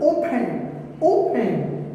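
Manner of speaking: "open" stressed on the O, falling away after; teacher's front-stressed "open", said twice; stress on first syllable. Shown as a demonstration. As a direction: lean into the first sound of "open". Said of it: "giving the stress at the beginning".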